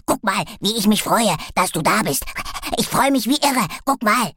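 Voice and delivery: loud, overjoyed little dog; bouncing with joy, loud; excited dog. A narrator's reading.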